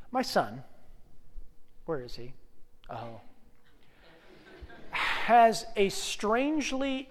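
ordinary talk

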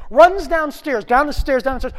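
loud, high rush of storytelling; frantic narration. A narrator's reading. Teller races through the dash downstairs, frantic. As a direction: race through it urgently.

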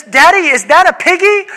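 put-on a small boy pointing at a pig; child's voice. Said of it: "Silas says".

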